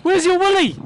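real panic; scared yell. Real, not acted. Frightened by game creature.

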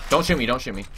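loud panicked plea; panicked yell. Real, not acted. Pleading mid gunfight.

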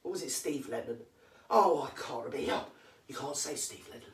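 ordinary talk, nothing marked

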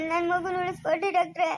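tearful begging to the doctor; weeping mother-to-be pleading; crying plea. Acted. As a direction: sob out the plea. Pleading doctor to save baby.

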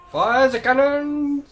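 high, loud, drawn-out captain's command; shouted battle order. Pretend. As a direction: bellow the order across the deck, stretching it out. Mock battle order during game.

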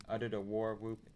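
usual speaking voice

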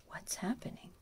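usual speaking voice

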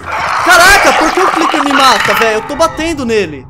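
loud and high complaining; frustrated shout. Real, not acted. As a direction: yell in disbelief. Being killed in game.

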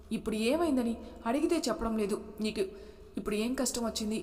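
ordinary delivery

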